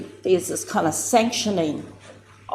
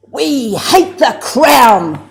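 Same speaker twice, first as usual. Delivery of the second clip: slow, loud, spat out with venom; venomous; 5 semitones higher, 10 dB louder, much slower than usual. Retold or imitated. Auntie rising at her first hapu meeting, spitting venom. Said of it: "said with such venom".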